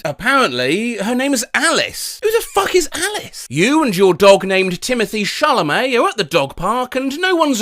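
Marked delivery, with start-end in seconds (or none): 2.2-3.4 higher, fast, baffled; outraged disbelief. Acted. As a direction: blurt it in disbelief. Outraged at hack.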